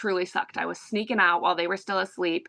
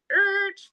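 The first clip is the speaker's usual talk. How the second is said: a high squealing brake screech in the voice; screeching brakes noise; 12 semitones higher, 3 dB louder than usual. Retold or imitated. Telling her friend no, a high mock brake screech. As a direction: mimic tires skidding to a stop. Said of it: "pump the brakes".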